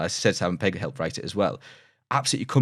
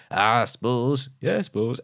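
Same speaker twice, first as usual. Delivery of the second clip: imitated Somerset farmer, pitched up; Somerset accent; 4 semitones higher, slower than usual. Farmer grudgingly agreeing about fences, Somerset accent. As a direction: draw it out in a broad Somerset burr. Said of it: "not Somerset, he goes".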